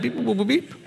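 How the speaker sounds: laughing through robot beeps; beep-boop cracking up; beeping while laughing. Shown as a demonstration. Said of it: "do that".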